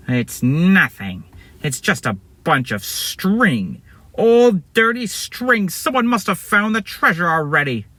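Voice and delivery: slow, drawn-out letdown in a put-on voice; disappointed bad guy. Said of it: "he looks sad and disappointed".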